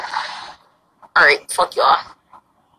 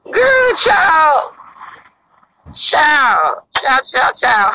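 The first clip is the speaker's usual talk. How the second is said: exasperated; 3 semitones higher, much slower than usual. Real, not acted. Dramatic exasperation at viewers.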